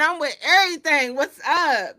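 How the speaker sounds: loud, high hyped-up trash talk; mock trash talk. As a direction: yell it like a cocky player. Retelling Wemby's trash talk loudly.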